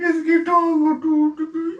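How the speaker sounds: stoner whine. Real, not acted. Husband whining high on bhang.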